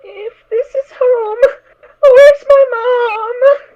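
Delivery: tearful, slow wail; a lost little filly on the verge of tears; sobbing, calling for her mother; crying for mom. Acted.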